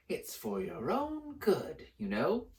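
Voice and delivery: slow, falsely pious; mock saintly. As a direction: pretend to care. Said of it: "in a saintly voice".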